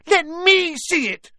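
very high, drawn-out loud whine; demanding. A narrator's reading. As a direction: screech a spoilt boy's demand. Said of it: "demanded Dudley".

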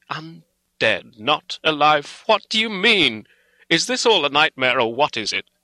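high, loud, distraught questioning; horrified disbelief. A narrator's reading. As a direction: demand answers in rising horror. Distraught Arthur questions the doctor.

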